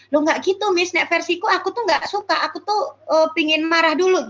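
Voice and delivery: put-on child protesting to the teacher, Miss. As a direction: push back like a child who feels unheard. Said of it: "yang tidak bisa diterima oleh anak".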